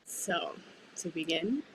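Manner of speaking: ordinary talk, nothing marked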